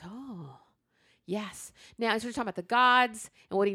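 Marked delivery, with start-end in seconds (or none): 0.0-1.7 hushed knowing reply. Retold or imitated. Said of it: "He goes".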